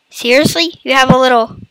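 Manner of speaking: normal talking voice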